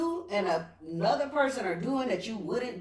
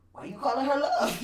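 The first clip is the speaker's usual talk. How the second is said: higher-pitched mock challenge; mock indignant; 5 semitones higher than usual. Girlfriend questioning his pet names, mock indignant. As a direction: play the jealous girlfriend, half joking. Acting out her jealous former self.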